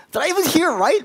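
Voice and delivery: high, startled self-question; can't believe what he just heard; disbelieving. Retold or imitated. Pastor doubting the words he heard, startled.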